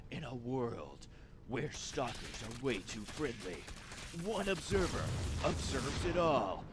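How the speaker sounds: low dramatic trailer announcer; movie trailer voiceover. Pretend. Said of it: "my movie trailer voiceover needs a little bit of work".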